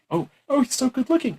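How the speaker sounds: high-pitched pretend gushing; mock swooning. Voter admiring the man in the nice tie, mock swooning. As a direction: imitate a smitten voter.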